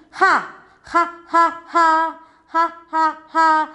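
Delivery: "Ha, ha, ha" as repeated breathy H sounds; h sound drilled. Shown as a demonstration. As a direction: drill the H sound in short puffs. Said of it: "What is the sound of H".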